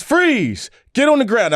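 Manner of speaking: cop shouting. Retold or imitated. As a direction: bark it like a cop. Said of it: "the cops".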